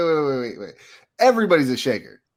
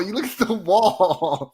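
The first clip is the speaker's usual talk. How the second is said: slow, drawn-out rule with a higher pitch; emphatic insistence; 4 semitones higher, much slower than usual. Real, not acted. Friend laying down urinal etiquette, drawn-out insistence. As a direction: stretch it out to lay down the rule.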